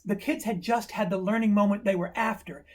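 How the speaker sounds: ordinary talk, nothing marked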